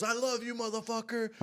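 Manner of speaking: high, loud holler of affection; euphoric mimicked shout. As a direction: imitate a wired friend professing love. Acting out euphoric friend's declaration.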